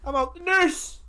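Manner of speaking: high comic voice of disbelief; mock outraged man. Mock figure reacting to the wife's word, high comic outrage. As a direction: play a scandalised bystander.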